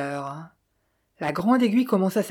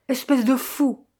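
higher-pitched, contained outburst; mild narrated yell; 6 semitones higher than usual. A narrator's reading. Madden insults Chan over the pearls, a mild yell. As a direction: throw out the insult as a restrained shout. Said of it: "hurla Madden".

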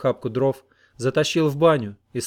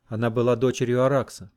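ordinary delivery

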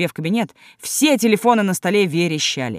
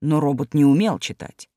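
usual speaking voice

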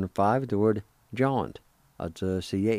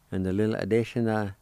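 ordinary talk

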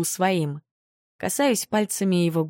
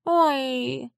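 high, sighing little creature; 7 semitones higher than usual. Acted. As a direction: sigh like a tiny pet. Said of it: "вздыхает Пушинка".

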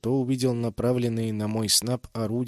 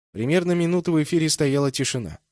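plain reading voice

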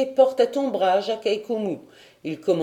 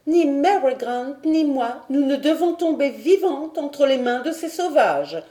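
higher, louder, resolute lady's voice; firm voice; 7 semitones higher, 3 dB louder than usual. Acted. Lady Helena tells her husband they must not be taken, firm and higher. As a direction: state it with unshakable resolve. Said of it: "dit-elle d'une voix ferme".

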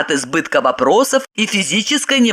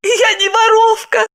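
high, upset denial; tearful protest. A narrator's reading. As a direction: protest innocence through acted tears. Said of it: "заливаясь слезами". (слёзно, высоким голосом)

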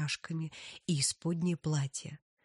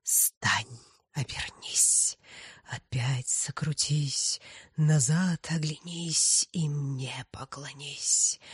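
sinister whisper; 3 dB louder, slower than usual. Acted. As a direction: cast a dark spell. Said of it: "зловещим голосом зашептала".

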